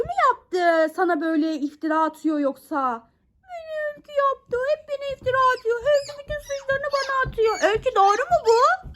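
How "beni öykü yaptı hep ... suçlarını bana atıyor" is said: high, quick complaining toy voice; tattletale puppet voice. Acted. Toy Miki blaming Öykü to mom, high tattling puppet voice. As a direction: tell on the kid in a little toy voice.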